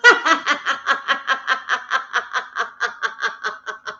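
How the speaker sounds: long, rhythmic forced belly laugh; cheese laughter. Shown as a demonstration. Yoga leader demonstrates cheese laughter, long forced laugh. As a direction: laugh on purpose, pulse after pulse, fading. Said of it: "we're gonna do some cheese laughter".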